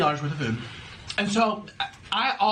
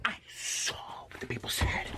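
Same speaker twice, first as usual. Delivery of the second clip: voiceless whisper; whispered aside about viewers' comments; 9 dB quieter than usual. Real, not acted. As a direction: breathe it as a secret.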